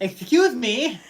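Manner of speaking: mock offended. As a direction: play at being insulted. Mock offense at Muppet remark.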